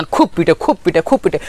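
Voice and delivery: ordinary delivery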